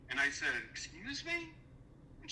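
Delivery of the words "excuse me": high, offended disbelief; incredulous retort, pitched well up; indignant excuse me. Retold or imitated. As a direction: shoot it back, high and stunned.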